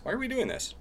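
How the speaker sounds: squeaky high repeat of the question; high mock voice. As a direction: pitch it way up, playful.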